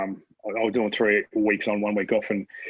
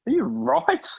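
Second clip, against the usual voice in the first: much higher, incredulous question; stunned by the kid, ask it way up high; amazed disbelief; 14 semitones higher, slower than usual. Retold or imitated. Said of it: "I'm looking at this kid like".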